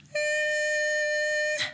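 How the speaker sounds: high nasal hum demo. Shown as a demonstration. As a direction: hum it high through the nose. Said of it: "is to use the sound".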